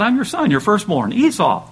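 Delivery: a touch of a son's eager claim; eager Esau. Acted. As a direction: announce yourself eagerly to your father. Esau claims blessing from Isaac.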